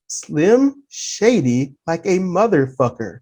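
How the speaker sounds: low, slow, soft reply; retold deadpan agreement. Dock attendant agreeing with the supervisor, retold low and slow. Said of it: "I looked at him, I said".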